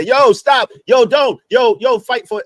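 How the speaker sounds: imitating shouting at attackers to stop; mock shout. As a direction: yell to stop a street fight. Acting out breaking up a fight.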